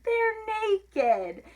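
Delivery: put-on high, gleeful little-girl punchline; giggly. A narrator's reading. Sister giggles about the noodles, high and giggly. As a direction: pitch it up high with a touch of giggle.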